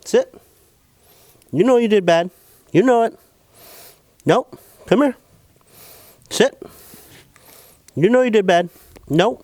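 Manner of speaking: high, loud scolding of a dog. Real, not acted. Owner scolding his dog for barking, high and loud. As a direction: call the naughty dog to you, firm and loud.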